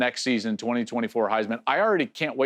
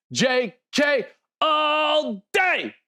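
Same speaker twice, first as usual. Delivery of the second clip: much higher, slow, drawn-out broadcaster call; Gus-style touchdown call; 12 semitones higher, much slower than usual. Retold or imitated. Analyst imitating Gus calling a touchdown, high and stretched. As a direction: sing out the catchphrase like a big TV call.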